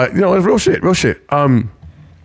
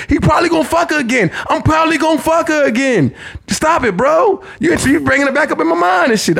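worked up; 8 semitones higher than usual. Real, not acted. Worked-up rant.

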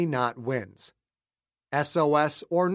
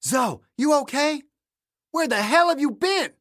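alarmed; 11 semitones higher, 3 dB louder than usual. Acted. Finds smoke in cockpit.

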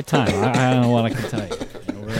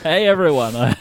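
town crier; 5 semitones higher than usual. Acted. Village square banging your GMT pan.

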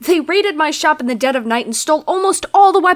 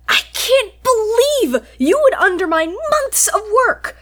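rising, outraged scolding up to the audacity; indignant rant; 6 semitones higher, 3 dB louder than usual. Acted. The bandit berates the lord for stealing, outraged. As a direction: tear into him for the theft.

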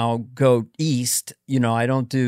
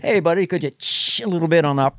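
retold sketch voice; 3 semitones higher than usual. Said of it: "I go".

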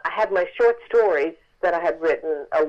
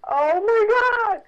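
stunned awe; 13 semitones higher, much slower than usual. Retold or imitated. Stunned on seeing the contract.

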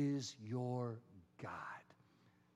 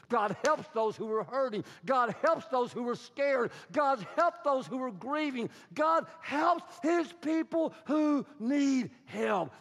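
rising higher and louder to a full shout; preaching at full force; 9 semitones higher, 8 dB louder than usual. Acted. Pastor preaching to his congregation, building to a shout. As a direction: build each line until the preacher is shouting.

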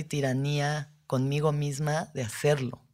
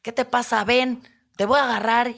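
loud, higher, harsh bully call; mock yell at a cat; 7 semitones higher, 6 dB louder than usual. Someone chasing a scared cat, loud harsh mock yelling. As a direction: bark at a scared cat to grab it. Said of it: "no le vas a decir".

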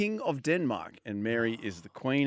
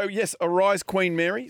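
royal proclamation; 6 semitones higher, 3 dB louder than usual. Acted. Proclaiming the new queen.

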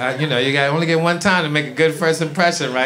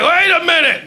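much higher, louder, startled; startled double take; 12 semitones higher, 4 dB louder than usual. Retold or imitated. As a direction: catch yourself in disbelief.

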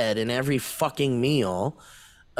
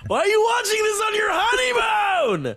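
incredulous shout. Real, not acted. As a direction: shout it in mock outrage at the viewer. Shouting at viewer on honeymoon.

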